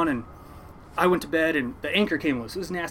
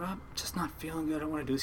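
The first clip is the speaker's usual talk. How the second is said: soft, reluctant grumble; feeling ill, softly begging off work; quiet, half-hearted protest; miserable complaint; 9 dB quieter, faster than usual. Retold or imitated. Sick kid answering his uncle.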